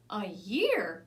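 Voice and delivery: a loud dismayed cry; child dismayed at a year's wait, shouting; shouted. A narrator's reading. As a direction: call it out in shock. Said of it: "shouted Tanya".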